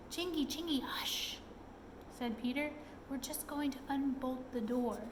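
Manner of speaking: soft hushed voice; hushing. A narrator's reading. Peter calming trapped Chingy, soft hushed voice. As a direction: shush him gently through the door.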